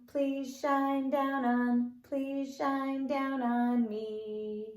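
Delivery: usual speaking voice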